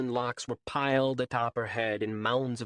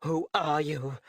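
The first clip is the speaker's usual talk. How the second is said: shaken; 2 semitones higher, slower than usual. A narrator's reading. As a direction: ask again, shaken and wary. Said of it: "Turning back with a pale face".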